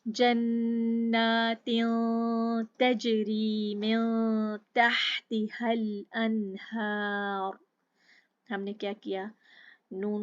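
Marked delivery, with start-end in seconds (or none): 0.1-7.6 very slow, louder chanted verse; tajweed recitation. Shown as a demonstration. Teacher recites the next verse for students, slow chant. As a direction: draw the verse out slowly. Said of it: "अब आगे देखें".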